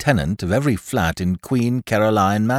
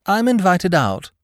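grand, higher and lofty; lofty, higher pitched boast; magnificently; 8 semitones higher than usual. A narrator's reading. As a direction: announce it like a great honour. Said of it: "said Lord Peter magnificently".

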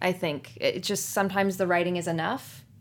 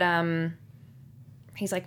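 usual speaking voice

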